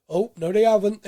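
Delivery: a hint of a football commentator; mock commentator. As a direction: jump in like a TV pundit catching himself. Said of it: "you can almost hear the commentary".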